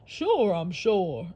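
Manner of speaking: slow alligator voice. Acted. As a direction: drawl it as the alligator. Alligator answers.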